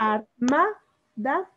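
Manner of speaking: Armada slowly split, MA lifted; teacher sounding out ar... MA... da in Armada; stress on MA in Armada. Shown as a demonstration. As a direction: break Armada into ar, MA, da, pressing on MA. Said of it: "por eso está marcada desde ahí".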